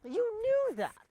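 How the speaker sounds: slow, high-pitched British outrage; hammed-up indignation; indignant accusation. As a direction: protest in a high, drawn-out voice. Reopens the quarrel.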